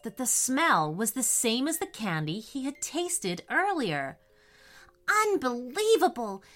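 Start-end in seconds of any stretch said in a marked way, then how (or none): 5.1-6.4 high, thrilled exclamation; delighted cry. Acted. Crosby sees Candyland for the first time, delighted. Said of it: "cried Crosby".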